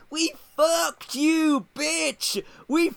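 drawn-out, high-pitched mock shouting; imitated rant. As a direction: yell it slowly, acting out a gloating TV rant. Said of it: "goes off on some rant".

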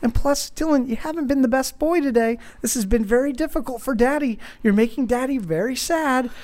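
high, pleading dad voice; acted appeal to a child's sympathy; emotional guilt-tripping. Dad working on his son's emotional side, guilt-tripping plea. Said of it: "trying to play with, like, his emo-, his emotional side".

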